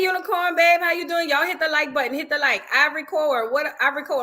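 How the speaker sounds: ordinary talk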